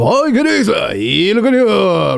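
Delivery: high, garbled greeting holler; mock yell. Sushi guy yelling at customers walking in, mock yell. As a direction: imitate a restaurant guy yelling out. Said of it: "The guy yells at you".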